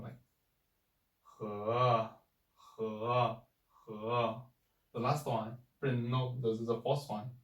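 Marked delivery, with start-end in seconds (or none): 1.4-4.3 bend each he down, then up; dipping third tone. Shown as a demonstration. Teacher showing the third tone on he. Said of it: "your turn around".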